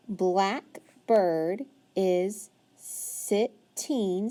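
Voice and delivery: very slow reading, each word drawn out; slow modeled reading. Shown as a demonstration. Teacher reading aloud to a child, very slow. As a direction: read slowly so the child follows. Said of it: "follow along as I read".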